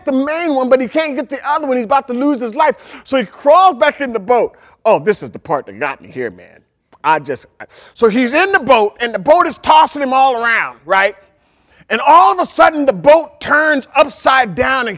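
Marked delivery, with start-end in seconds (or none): none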